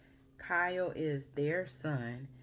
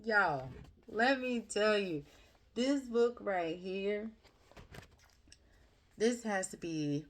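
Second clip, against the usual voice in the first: higher and louder, bursting to praise the book; excited gushing; 7 semitones higher, 3 dB louder than usual. Real, not acted. Telling viewers about her favourite book, excitedly. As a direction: let it spill out, thrilled.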